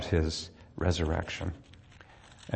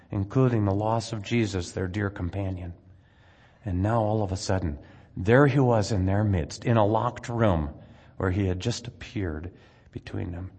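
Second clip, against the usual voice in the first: ordinary delivery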